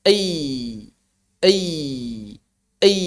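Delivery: ei pressed gently; just press lightly for ei. Shown as a demonstration. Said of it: "cukup ditekan saja". (ya ditekan ringan)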